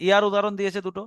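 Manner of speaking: ordinary talk, nothing marked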